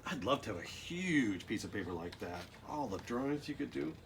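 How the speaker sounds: ordinary delivery, nothing marked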